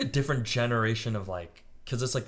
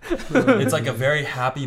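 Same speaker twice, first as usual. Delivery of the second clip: laughing; 11 semitones higher, 9 dB louder, slower than usual. Real, not acted. Describing a goofy photo, laughing.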